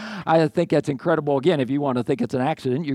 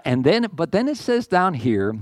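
ordinary delivery

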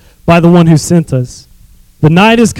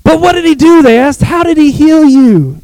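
high, pressing questions; hammed-up suspicious questioning; high incredulous Pharisees; 9 semitones higher than usual. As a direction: interrogate in disbelief. Pharisees interrogate.

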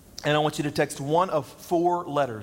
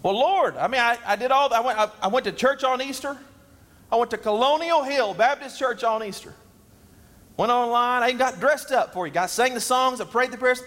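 higher, louder self-justifying voice; defensive churchgoer; 8 semitones higher, 3 dB louder than usual. Pretend. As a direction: list your good deeds like an excuse. Pretend churchgoer excusing himself.

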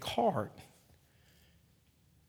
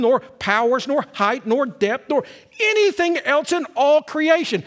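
louder, proclaimed scripture; fervent scripture reading; 6 semitones higher, 6 dB louder than usual. Acted. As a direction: proclaim the verse to the congregation. Reads Romans with fervor.

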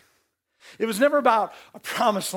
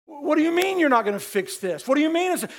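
mock complaint; 6 semitones higher than usual. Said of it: "You get ticked off at God".

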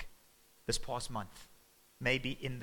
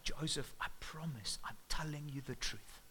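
softer, whispered tearful plea; 8 dB quieter than usual. Acted. Mary pleading with Joseph in tears, whispered and much softer. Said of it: "in tears".

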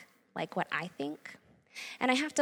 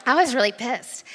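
much louder, forceful declaration; loud outburst; 4 semitones higher, 10 dB louder than usual. Real, not acted. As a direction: say it out loud, no more hiding it. Repeats the admission at full volume.

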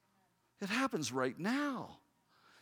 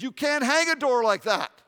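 higher, louder flat objection; raised, higher voice of a doubting father; dad objecting; 7 semitones higher, 10 dB louder than usual. Retold or imitated. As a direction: put your foot down like a stubborn dad.

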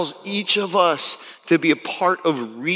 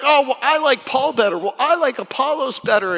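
mock petty squabbling, pitched up; hammed-up petty church members; whiny bickering churchgoers; 9 semitones higher than usual. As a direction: whine it like squabbling kids.

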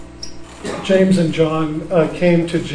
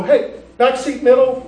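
mock eager call; 8 semitones higher than usual. Joke call nobody makes.

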